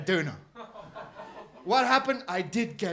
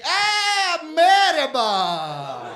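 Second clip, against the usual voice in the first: put-on loud, much higher "Ah", then a very slow drawn-out "merhaba" in a Turkish accent; Turkish shopkeeper greeting; 8 semitones higher, 9 dB louder, much slower than usual. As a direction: greet him like a delighted Turkish kebab man. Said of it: "the guy looks at me".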